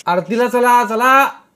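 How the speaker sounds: high, loud summoning call; calling out to everyone. A narrator's reading. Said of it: "रवीने सर्वांना आवाज दिला".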